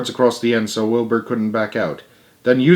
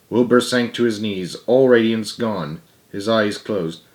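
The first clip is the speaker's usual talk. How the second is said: plain reading voice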